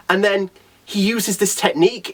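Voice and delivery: normal talking voice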